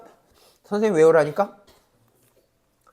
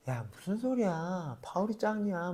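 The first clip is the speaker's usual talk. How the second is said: quiet mock partisan; 18 dB quieter than usual. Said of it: "막 이러면서".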